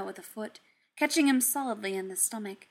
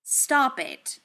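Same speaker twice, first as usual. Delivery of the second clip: firm; 4 semitones higher, 5 dB louder than usual. Acted. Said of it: "he said instead".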